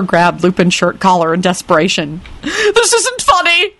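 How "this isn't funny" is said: very high, drawn-out desperate screech; violent screech. Acted. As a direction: screech it slowly at the top of your range. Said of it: "he screeched violently".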